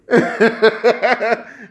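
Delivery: high rapid cackle; high-pitched laughing fit; real laughter. Real, not acted. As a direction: burst out giggling at your own story. Cracks up at his own story.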